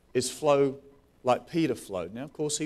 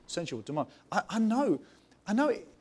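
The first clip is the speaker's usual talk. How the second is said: ordinary talk, nothing marked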